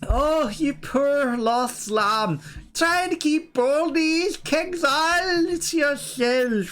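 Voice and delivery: high, slow, wailing preacher; mock drunk vicar. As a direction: preach greed like a tipsy vicar.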